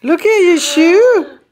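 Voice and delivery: high, slow cooing excitement. Real, not acted. Excited baby talk about shoe.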